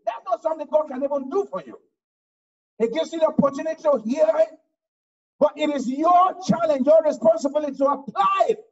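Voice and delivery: loud, fast sermon climax; fiery preaching. Acted. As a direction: preach it hard to the pews. Preacher exhorting the congregation.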